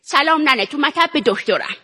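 put-on elderly Iranian grandmother voice; character voice of an old woman; old granny. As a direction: play her as an affectionate old granny. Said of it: "چرا پیر شدی؟".

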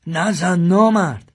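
man screaming at the gunman, desperate; shouting. A narrator's reading. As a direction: scream at him to stop shooting. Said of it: "داد زد".

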